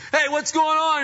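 high, loud holler of complaint; calling out. Retold or imitated. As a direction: shout up the line in alarm. Packers calling up the line.

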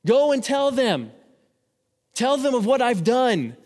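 slow, high and loud commanding call; booming, drawn-out voice of God sending out disciples; God's command. Retold or imitated. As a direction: proclaim the commission like a divine order. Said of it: "He says".

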